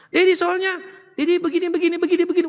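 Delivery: high-pitched mock daughter listing complaints; daughter complaining. Preacher imitates daughter complaining about her dad, high-pitched. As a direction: imitate the daughter rattling off faults. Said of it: "Dedi gimana orangnya?".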